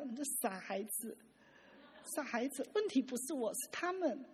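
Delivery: gentle fond reply. Retold or imitated. God smiling gently at his child.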